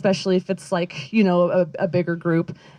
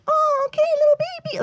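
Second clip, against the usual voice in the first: put-on much higher sing-song baby talk. Mocking how people talk to their pets, high baby talk. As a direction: coo like talking to a tiny baby. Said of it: "they do, like, the baby voice".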